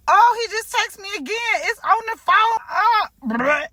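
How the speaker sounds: flustered by the text; nervous excitement. Real, not acted. Reacting to his text.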